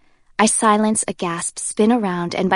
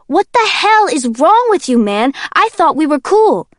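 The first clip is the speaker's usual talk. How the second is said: much higher, loud outraged yell; outraged Kenji; 8 semitones higher, 8 dB louder than usual. Acted. Kenji yells at Warner after the knock on the head, outraged. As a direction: yell in betrayed disbelief.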